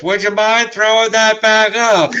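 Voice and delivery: tiny high pixie voice; small squeaky voice calling up. Acted. Tiny voice from below.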